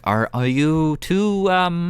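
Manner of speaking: high, slow, halting question; hesitant brother. Acted. Brother asking the two strangers who they are, high and halting. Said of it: "just goes".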